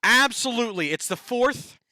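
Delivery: host hyping the Halo face-off to viewers, excited and loud. Real, not acted. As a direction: pump up the big showdown, loud and high. Hyping upcoming Halo challenge.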